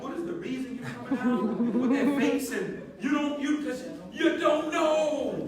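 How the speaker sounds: dad fussing at his moody daughter, laughing; mock exasperated dad. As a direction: play the baffled father, half laughing. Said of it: "parent scolding moody daughter".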